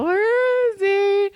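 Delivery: slow, high mock-worried call; drawn-out, half-hearted worried friend. Friends searching for drunk man.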